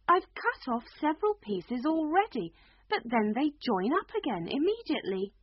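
put-on a puzzled little girl; young girl voice. A narrator's reading. Alice voice.

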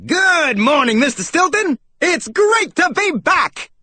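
high, booming salesmouse greeting; loud voice. A narrator's reading. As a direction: belt out a hearty, brash hello. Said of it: "he replied in a loud voice".

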